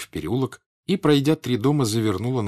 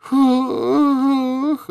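long, high wailing moan; high drawn-out howl of dismay; 7 dB louder than usual.

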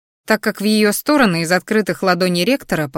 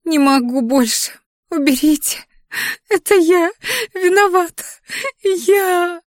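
high crying confession; sobbing scream; 11 semitones higher than usual. A narrator's reading. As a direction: scream it through tears. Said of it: "закричала она, обливаясь горькими слезами".

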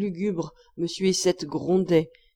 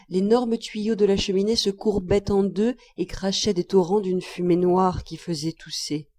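usual speaking voice

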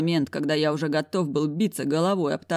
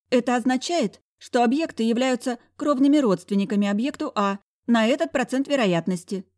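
higher, flustered secretary voice; hiccupping secretary; 8 semitones higher than usual. A narrator's reading. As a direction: answer like a startled clerk. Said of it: "икнула бабища".

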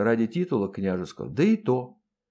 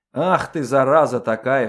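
loud, raised angry outburst; boiling over; 7 dB louder than usual. A narrator's reading. Colonel boiling over at the nosy maid, angry outburst. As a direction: explode at the intruder. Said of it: "вскипел Андрей".

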